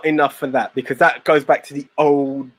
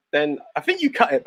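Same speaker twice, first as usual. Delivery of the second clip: ordinary delivery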